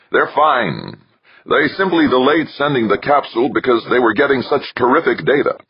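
high-pitched, thrilled report; excited. A narrator's reading. Johansson reporting that the crew is fine, excited.

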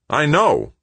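jumpy, high irritated reply. A narrator's reading. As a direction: brush him off impatiently.

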